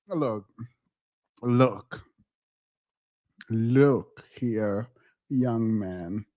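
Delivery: put-on low, drawn-out look here, young man; mock stern scolding. Streamer scolding a young man in chat, low and stern. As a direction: scold him like a stern elder, slowly.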